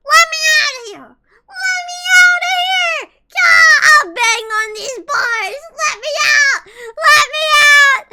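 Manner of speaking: high, loud, crying shout to be freed; mock desperate shout. Prisoner begging to be let out, loud mock cry. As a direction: beg through the bars, hammed-up. Pretend prisoner banging bars.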